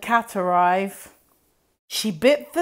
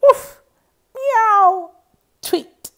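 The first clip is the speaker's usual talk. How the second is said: loud woof, high meow, chirped tweet; each animal cheering in turn; animal noises; 12 semitones higher, 6 dB louder, slower than usual. Acted.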